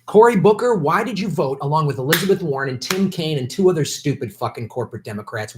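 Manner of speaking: ordinary talk, nothing marked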